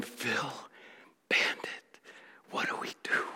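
acted-out breath of a whisper; very soft, stunned whisper; hushed whisper. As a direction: whisper it helplessly, with pauses. Stunned men around the dead dog.

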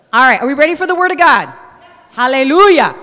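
rallying call before the sermon; preacher's call. Real, not acted. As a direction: fire up the room for the word. Preacher calling congregation to readiness.

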